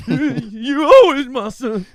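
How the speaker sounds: slow, loud quote through laughter; can't get the line out without cracking up; laughing impression. Mocking the father's line while laughing, loud and slow.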